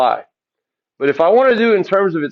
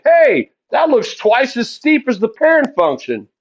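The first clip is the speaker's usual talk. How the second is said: higher-pitched, mildly excited student reaction; an acted "hey!" of discovery, pitched up; mock realisation; 8 semitones higher than usual. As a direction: pipe up as if spotting it instantly. Voices student's instant realisation.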